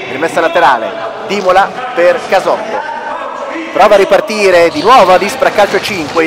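normal talking voice

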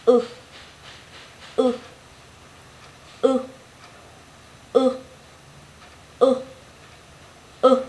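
short, cut-off U with lightly rounded lips; âm u ngắn. Shown as a demonstration. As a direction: say U very briefly. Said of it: "mình phát âm ngắn hơi thôi". (âm U ngắn, môi hơi tròn)

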